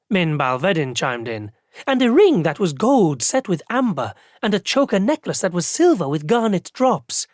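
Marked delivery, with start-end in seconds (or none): 1.9-7.3 a hint of a higher, eager young clerk; young clerk chiming in to finish the list; lightly voiced eager clerk. A narrator's reading. Second clerk chimes in.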